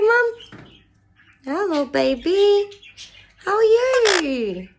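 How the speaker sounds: usual speaking voice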